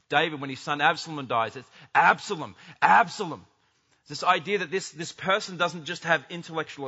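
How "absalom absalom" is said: anguished cry. Acted. David grieving his son.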